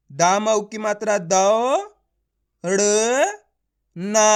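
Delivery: दौ, ड़, ना drawn out with pauses; letter by letter. Shown as a demonstration. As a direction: spell it out, isolating दौ, ड़ and ना. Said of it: "एक एक अक्षर का उच्चारण".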